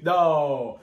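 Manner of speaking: usual speaking voice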